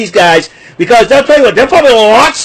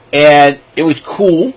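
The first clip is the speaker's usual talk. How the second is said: ordinary talk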